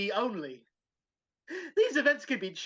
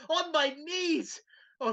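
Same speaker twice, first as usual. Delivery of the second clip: normal talking voice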